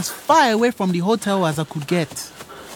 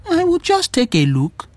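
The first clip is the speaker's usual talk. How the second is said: high, lilting self-excuse; sneaky curiosity; 8 semitones higher than usual. Acted. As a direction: say it lightly and high, as if innocent. Sneaking into a guest room.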